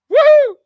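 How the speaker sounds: very high, loud whoop of joy; triumphant woo. Real, not acted. Cheering his own right prediction, victory whoop.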